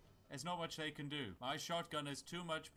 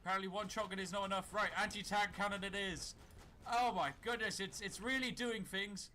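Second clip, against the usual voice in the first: higher and louder, thrilled by the chaos; excited yelling; 6 semitones higher, 5 dB louder than usual. Real, not acted. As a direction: cheer the mayhem on. Fighting a dragon with guns.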